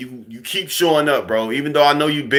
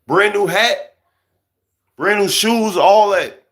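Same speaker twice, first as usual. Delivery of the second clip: mocking hype; 9 semitones higher, 5 dB louder, slower than usual. Mocking a fresh-dressed guy.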